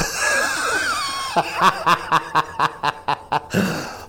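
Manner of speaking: storyteller cracking up at his own story. Real, not acted. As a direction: roar with laughter. Storyteller bursts out laughing.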